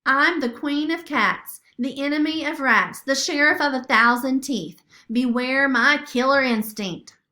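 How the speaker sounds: loud, grand boast; swaggering declaration; boastful crowing. A narrator's reading. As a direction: proclaim it like royalty. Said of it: "Sophia crowed".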